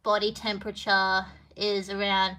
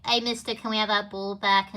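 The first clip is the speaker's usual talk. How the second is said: normal talking voice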